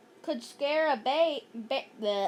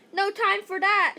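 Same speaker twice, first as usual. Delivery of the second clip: louder, higher urgent shout; 5 semitones higher, 5 dB louder than usual. Acted. Andrea refusing to run for help, shout. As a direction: cut them off urgently. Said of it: "shouted Andrea".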